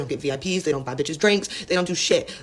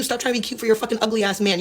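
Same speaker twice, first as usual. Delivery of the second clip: ordinary talk, nothing marked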